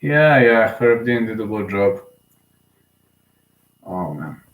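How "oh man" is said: low, soft defeated groan; quiet dejected sigh; sad sigh. Real, not acted. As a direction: let it sink in heavily. Sad at hero's retirement.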